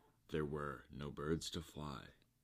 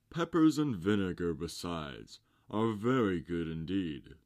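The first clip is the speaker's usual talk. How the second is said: loud, higher, relishing voice; hungry walrus; 4 semitones higher, 9 dB louder than usual. A narrator's reading. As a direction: savor the words, loud and greedy. Walrus announces feeding.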